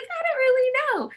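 high, sheepish little voice; shy own voice. Retold or imitated. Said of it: "And I was like".

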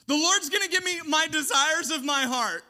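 loud, high mock entitlement; mock the greedy believer; sarcastic mimicry. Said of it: "I know I'm being sarcastic".

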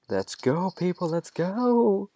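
high, loud, stretched last word; hammed-up impatient holler, rising in pitch; freaking-out shout. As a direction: yell it to hurry everyone along. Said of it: "Freaking out".

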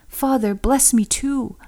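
slow, high, tearful plea; weeping aloud. A narrator's reading. Esau pleading again with his father, slow and weeping. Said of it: "Esau wept aloud".

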